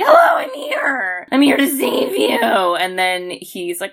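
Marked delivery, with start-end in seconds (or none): 0.0-2.4 high breathy cry of arrival; mock frantic mom. Charlie's mom arriving to rescue him, high and breathy. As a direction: burst in like a rescuing mother. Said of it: "his mom is like".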